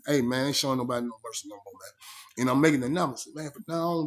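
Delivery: ordinary talk, nothing marked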